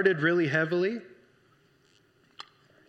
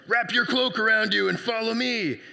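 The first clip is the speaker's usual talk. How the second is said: angel voice; 4 semitones higher, 4 dB louder than usual. Retold or imitated. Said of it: "That's how it is in my head".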